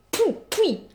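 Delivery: sharp high spitting sound; a scornful "pah!"; contemptuous spit. Acted. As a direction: spit at him in disgust. Spitting at groveling ex.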